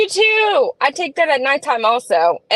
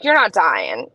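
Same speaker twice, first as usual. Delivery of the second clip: retold reassurance; 6 semitones lower, slower than usual. Imitates partner's dismissive reply.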